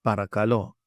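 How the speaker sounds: "Paracalo" with emphasis on the last syllable; stress on final LO. Shown as a demonstration. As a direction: say "Paracalo" whole, leaning on lo. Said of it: "Put a little emphasis on that spot".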